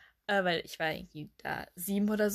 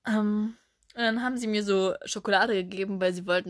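ordinary talk

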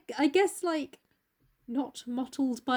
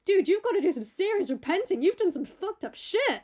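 high, emphatic mock scolding; appalled Basil; 8 semitones higher, 4 dB louder than usual. Painter telling Dorian to repent, appalled. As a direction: lecture a friend in hammed-up alarm.

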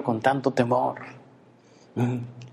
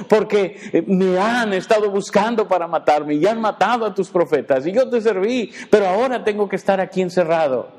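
lightly voiced anxious prophet, a little higher and louder; complaining Elijah; 7 semitones higher, 3 dB louder than usual. Preacher voicing Elijah's fearful complaint to God, lightly whiny. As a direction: plead like a frightened man hiding in a cave.

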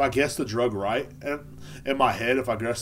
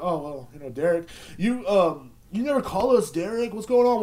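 imitated nagging family, climbing higher and louder; guilt-tripping relatives; 5 semitones higher, 3 dB louder than usual. Imitating family blaming Derek for not calling, guilt trip. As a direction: pile on the guilt as it builds. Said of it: "they'll give me a guilt trip".

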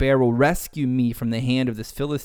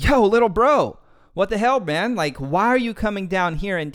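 higher, louder hot-headed big brother; older brother getting hot; 7 semitones higher, 3 dB louder than usual. Retold or imitated. Big brother scolding David on battlefield, heated and higher. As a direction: snap at your little brother like he's embarrassing you. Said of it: "his older brother got hot".

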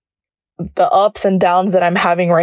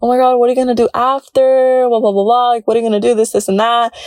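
higher-pitched, mock-excited busybody; imitating nosy people; 5 semitones higher than usual. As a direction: pester them like an overeager well-wisher. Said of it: "people would tell me".

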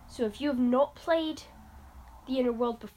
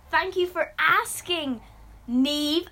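pointed thanks; 4 semitones higher, 6 dB louder, much slower than usual. Acted. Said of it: "Wow, sheesh".

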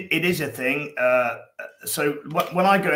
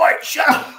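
mock shout, much higher and louder; parent snapping at kid; 9 dB louder than usual. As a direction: bark it at an annoying kid. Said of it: "you wanna go".

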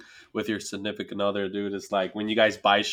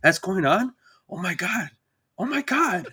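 mock amazed, rising high; fake interested husband; 6 semitones higher than usual. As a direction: pretend to follow her talk. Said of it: "I'll talk to her like".

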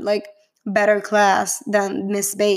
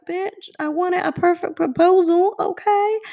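high-pitched, put-on diva protest; mock indignant demand; 8 semitones higher than usual. Viewer recalling her first reaction to the proposal, mock indignant. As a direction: play up the demanding complaint, pitched way up.

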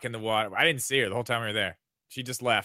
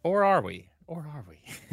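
teasing; slower than usual. Acted. Teasing hint about leaked console.

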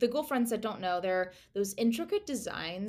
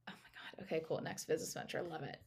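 whisper; 4 semitones lower, 9 dB quieter, much faster than usual. Real, not acted. Quiet aside before starting.

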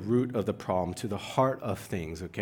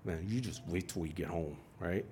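put-on low, hushed threat to a child; contained parental anger; 6 semitones lower, 12 dB quieter than usual. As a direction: warn the kid under your breath. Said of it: "That's like the anger that arises".

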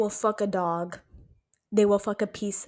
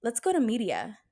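ordinary delivery

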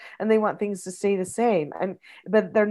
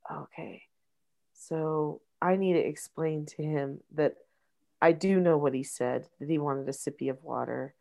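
quiet self-talk; 5 semitones lower, 5 dB quieter than usual. Retold or imitated. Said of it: "And I went".

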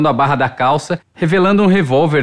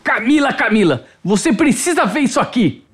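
put-on higher, eager as an excited child; animação infantil; 7 semitones higher than usual. A narrator's reading. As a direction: call her over with childlike excitement. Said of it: "demonstrando um ânimo inesperado, quase como uma criança". (animado, quase como uma criança)

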